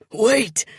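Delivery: high, loud panicked shout; panicked cry. A narrator's reading. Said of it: "The man's voice rises, panic".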